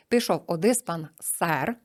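диспансер stressed on the last syllable, сер; slow, stress диспансЕр; rhyme stress on сер. Shown as a demonstration. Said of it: "ми бачимо сер, диспансер римується". (повільно, наголос на останній склад у слові диспансер)

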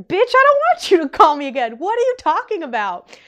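shouting back at the trainer who snubbed her; indignant retort. Retold or imitated. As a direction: snap back at her, offended.

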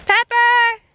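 loud, high call across the field; loud high-pitched call to a missing animal. Real, not acted. Calling animals across the farm.

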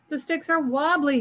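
high-pitched leopard grumble; complaining. A narrator's reading. Leopard on wobbly sticks to Anansi, high complaint. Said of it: "he complained".

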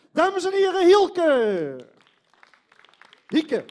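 loud stage announcement; announcer call. Real, not acted. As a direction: call the name out big. Host announcing performer on stage.

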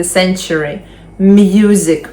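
slow, careful model words; first-syllable stress drill. Shown as a demonstration. Teacher drilling words for students to repeat. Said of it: "повторюємо за мною".

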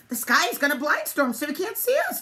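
high, loud excited dog; announcing. A narrator's reading. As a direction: proclaim the good news. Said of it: "Dog announced".

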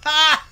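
one loud, high "Ha!"; sudden roaring laugh. A narrator's reading. Boss hears of the wedding, sudden roar of laughter. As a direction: bark out a single explosive laugh. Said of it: "he roared suddenly".

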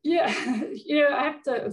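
amused laugh breaking into the words. Real, not acted. Guest reacting to an audience question, laughing. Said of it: "sorry that I'm kind of laughing".

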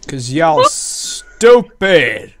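loud, slow, drawn-out taunt; a hammed-up jeering yell; mock insult. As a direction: stretch it out to rub it in. Teasing insult at teammate.

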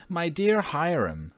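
high, slow wifely appeal; exasperated cry. A narrator's reading. Wife appeals to Hiram about the fainting housekeeper, in a high slow cry. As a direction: call to your husband in dismay. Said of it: "cried Mrs. Otis".

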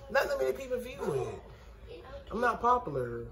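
normal talking voice

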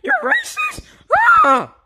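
very high shriek of alarm; horrified cry. Acted. Reacting to the possessed one's insult, shrieking high. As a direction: cry out in comic horror.

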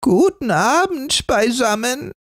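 put-on an old beggar woman's creaking greeting; creaky old woman. A narrator's reading. Old beggar woman greeting the family, creaky. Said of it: "sagte sie mit knarrender Stimme".

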